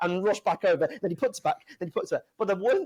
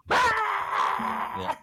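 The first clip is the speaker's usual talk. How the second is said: high, strangled mock yell instead of quitting; mock scream; 12 semitones higher than usual. Telling how Backlund ends the match with a yell. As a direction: squeal out like Backlund giving up. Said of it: "Backlund just goes".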